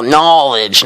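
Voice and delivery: mock self-help guru drawling knowledge; imitating a guru. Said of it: "the videos where the guy's all".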